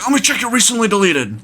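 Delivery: high-pitched demanding imitation; retold yelling. Angry man demanding to search the phone, high mock yell. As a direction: push it out high, mimicking an irate man. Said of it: "he's yelling at me".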